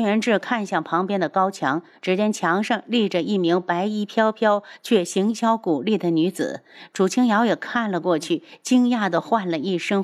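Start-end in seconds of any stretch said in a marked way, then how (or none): none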